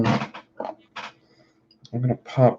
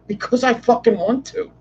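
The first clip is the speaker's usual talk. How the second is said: defiant; 10 semitones higher than usual. Pretend.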